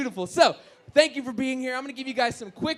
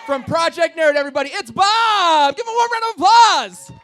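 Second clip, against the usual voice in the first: loud, high introduction; announcer hype; 7 semitones higher, 11 dB louder than usual. Real, not acted. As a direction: announce like a ring MC. Announcing guest to crowd.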